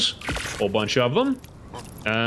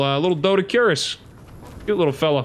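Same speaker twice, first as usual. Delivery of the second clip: usual speaking voice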